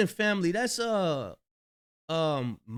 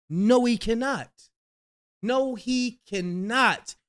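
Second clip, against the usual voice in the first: higher, insistent denial, slower on the repeat; emphatic disbelief; 7 semitones higher, 3 dB louder, slower than usual. Real, not acted. Host disputing [name]'s swimming claim, emphatic denial. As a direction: flatly refuse to believe it, drawing out the repeat.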